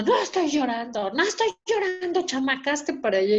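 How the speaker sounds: mother's indignant denial. Retold or imitated. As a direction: imitate mom snapping a denial and shooing the child. Mother's irritated denial, acted.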